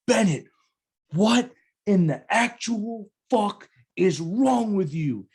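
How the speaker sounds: high, slow furious yell; angry sergeant major. Retold or imitated. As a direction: chew him out like an enraged sergeant major. Angry sergeant major yelling at him.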